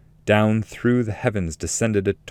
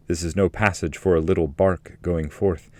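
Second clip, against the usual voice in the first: usual speaking voice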